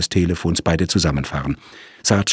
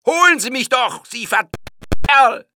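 much higher, loud furious yell; trapped murderer rages back at the police; raging; 4 dB louder than usual. A narrator's reading. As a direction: spit it out in blind rage. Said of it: "tobte der Mann".

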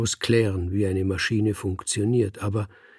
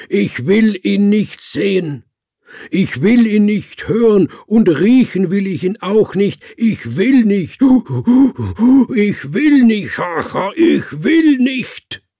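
higher and louder with every repeat, ending in a frantic scream; raging shout; 11 semitones higher, 10 dB louder than usual. A narrator's reading. Dying Rotpeter rages against death, climbing shouts. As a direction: scream it out, losing control. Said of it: "brüllte er".

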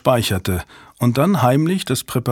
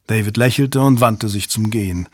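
ordinary delivery

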